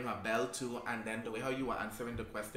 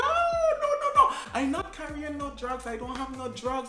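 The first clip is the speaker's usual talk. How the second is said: very high loud frantic pleading; panicked wailing; 11 semitones higher, 7 dB louder than usual. Retold or imitated. Denying drugs to the officer, panicked and very high. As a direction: plead hysterically, voice cracking up. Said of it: "acting like this, making the situation even worse".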